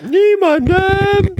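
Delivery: high, loud, slow exclamation; dramatic shout. Pretend. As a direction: cry it out theatrically. Dramatic echo of the line.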